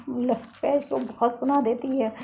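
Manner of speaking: ordinary delivery, nothing marked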